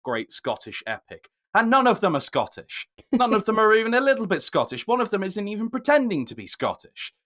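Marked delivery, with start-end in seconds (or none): 1.5-7.1 much higher and louder, incredulous; indignant disbelief. Real, not acted. Film podcaster ranting about the cast of a Scottish epic, indignant. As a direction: protest it in high, loud disbelief.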